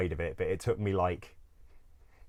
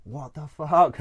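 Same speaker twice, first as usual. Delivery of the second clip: higher and louder startled what the fuck; shocked outburst; 8 semitones higher, 8 dB louder, slower than usual. Retold or imitated. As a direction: blurt it out in disbelief. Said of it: "it's just like".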